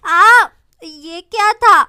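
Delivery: loud, slow frightened shriek; startled scream. Acted. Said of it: "ज़ोर से चिल्लाती है". (ज़ोर से डरी हुई चीख)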